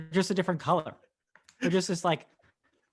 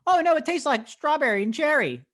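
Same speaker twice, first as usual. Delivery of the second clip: high-pitched, loud mock enthusiasm; imagined excited taster; 13 semitones higher, 5 dB louder than usual. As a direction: gush in a squeaky put-on voice. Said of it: "if they're like".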